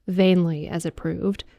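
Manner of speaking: plain reading voice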